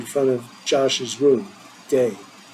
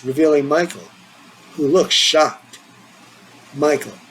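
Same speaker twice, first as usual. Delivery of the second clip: ordinary delivery, nothing marked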